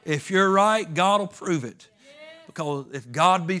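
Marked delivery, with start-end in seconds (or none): 0.0-1.9 loud and higher, preaching it home; louder repeat. Shown as a demonstration. Preacher to congregation, repeating the point loudly. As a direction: repeat it louder so they hear.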